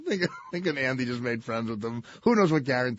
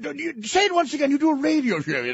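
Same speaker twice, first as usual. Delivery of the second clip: posh English tourist; 12 semitones higher than usual. Acted. English couple greeting the radio host, mock English accent. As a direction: put on a hammed-up English toff, pitched up. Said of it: "horsey face English people".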